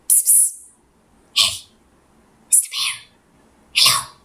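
whispered psst through the window; sneaky whisper. Acted. Duck whispering at the bear's window at night, sneaky hushed call. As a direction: hiss it like a sneaky night visitor.

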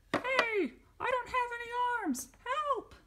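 high, panicked papa dinosaur; high squeaky dino voice. Acted. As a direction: squeal it out, armless and frantic.

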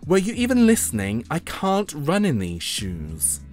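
put-on high, whiny teen-diva complaint; put-upon, sassy objection; exasperated. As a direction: protest it with indignant swoops, dragging the end. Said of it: "sighed Phoebe".

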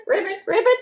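ordinary delivery

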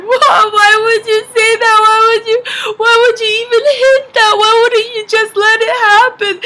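high, loud mock wail; hammed-up tearful whine at mom; crying and laughing. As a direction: wail in protest, half laughing. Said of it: "I started crying in the car".

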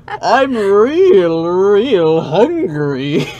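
very high, loud, slow villain; sweet creepy coaxing, stretched out; Bane voice. Acted. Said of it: "Bane was way better choice".

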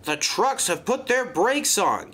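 high-pitched, strained alarm; straining gasp. A narrator's reading. Peter Sam straining against his trucks, gasping in alarm. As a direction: gasp it out in distress. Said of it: "he gasped".

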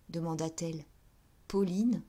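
usual speaking voice

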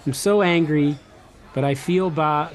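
ordinary talk

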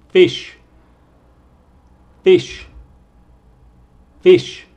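fish said loud and high, vowel drawn out; stretched vowel demo. Shown as a demonstration. Teacher drilling the vowel for students, loud and clear. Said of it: "Vamos a empezar con el fish".

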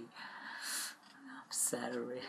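slow, soft whisper on the edge of tears; tearful whisper. Real, not acted. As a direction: barely get it out, whispering and close to crying. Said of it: "I'm crying already".

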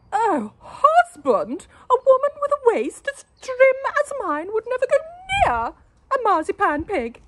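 very high, loud, airy protest; vain wife. A narrator's reading. Wife denying eating the pig, high and loud. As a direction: brush it off in a high lady's voice. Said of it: "wife answers husband".

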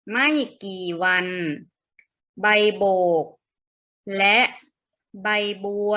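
word-by-word teaching read. Acted. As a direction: read each phrase separately for beginners to follow. Reads story word by word.

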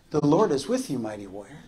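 soft, low and fading; hushed voice of truth. Acted. Preacher voicing truth to the fearful, hushed. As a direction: lay it down gently like a promise. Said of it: "the voice of truth was saying".

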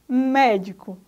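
MÉDICO with a loud first syllable MÉ; antepenúltima sílaba tônica. Shown as a demonstration. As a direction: punch the MÉ at the start of médico. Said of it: "a sílaba tônica é mé". (médico, com força na sílaba MÉ)

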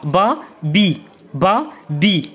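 با then بي, "ba, bee", each one syllable; blended syllables. Shown as a demonstration. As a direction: contrast open a in با with i in بي. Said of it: "شنو غتقرا؟".

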